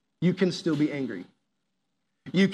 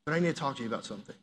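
gentle approach; 2 semitones lower, 7 dB quieter, faster than usual. Retold or imitated. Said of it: "you stopped and you're like".

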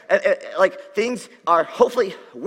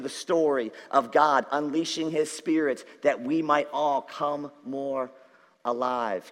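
hushed preaching; 4 semitones lower, 4 dB quieter than usual. Acted. Quiet slow preaching of new birth.

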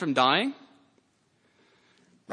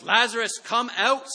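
calling out high and loud; commanding shout into the tomb; 9 semitones higher, 6 dB louder than usual. A narrator's reading. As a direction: cry it out to the dead man. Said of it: "Jesus called out in a loud voice".

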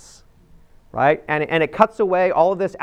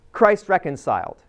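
usual speaking voice

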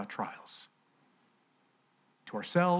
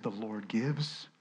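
mild mournful Job; 4 semitones lower, 7 dB quieter than usual. Retold or imitated. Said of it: "And he said".

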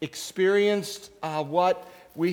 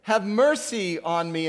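louder, pleading cry; crying out more; 4 semitones higher, 6 dB louder than usual. Acted. Blind beggar crying out again despite the crowd, louder plea. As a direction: cry out all the more, begging. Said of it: "he cried out all the more".

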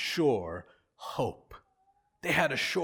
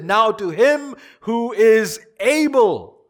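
loud, high and slow; emphatic proclamation; 6 semitones higher, 9 dB louder, slower than usual. Real, not acted. Preacher proclaiming God's power to the congregation, emphatic. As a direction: build each word into a ringing proclamation.

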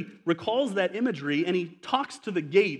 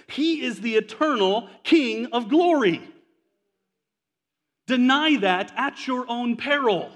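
building sermon, raised pitch and volume; preaching; 4 semitones higher, 3 dB louder than usual. Acted. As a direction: preach it with rising fervor. Preaching with rising force.

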